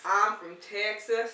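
slow Texas drawl; put-on Texan accent, unhurried; Southern American accent. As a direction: draw it out like a Texan. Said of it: "like Southern American".

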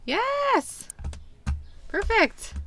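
very high, drawn-out celebration; excited cheer. Real, not acted. Player celebrating shrinking debt, high excited cheer. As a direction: squeal it triumphantly.

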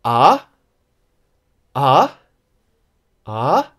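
AH swept upward; each short AH rises steeply; rising tone. Shown as a demonstration. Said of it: "we can call it a rising tone".

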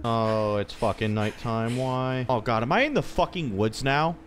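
ordinary talk

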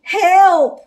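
high, loud cry for help; storybook yell of alarm. A narrator's reading. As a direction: shout for rescue. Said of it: "Shaggy cried".

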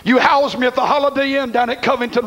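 normal talking voice

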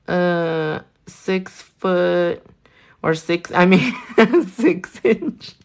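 normal talking voice

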